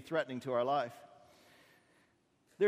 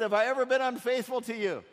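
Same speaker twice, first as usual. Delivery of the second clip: louder, higher hurt protest; donkey defends itself loudly; indignant donkey; 6 semitones higher, 6 dB louder than usual. Acted. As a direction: remind him of loyalty. Donkey pleads with master.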